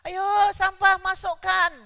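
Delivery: loud, high-pitched bossy shout; mock yelling. Mother ordering others to bin the rubbish, yelling. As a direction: yell it out as a nagging order. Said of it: "ibu-ibu teriak-teriak".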